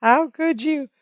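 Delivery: a light mock shriek; mock scream. Jennifer screaming over the phone, light high mock wail. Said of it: "You could hear her scream".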